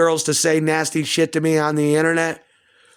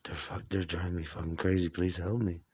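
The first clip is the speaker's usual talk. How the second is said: hushed, breathy whisper close to her ear; whispered plea to his mother; 10 semitones lower, 14 dB quieter than usual. Retold or imitated. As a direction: lean in and whisper it desperately. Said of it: "I'll whisper shit to my mother".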